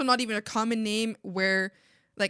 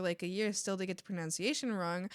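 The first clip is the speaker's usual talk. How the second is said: normal talking voice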